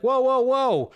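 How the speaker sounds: ordinary delivery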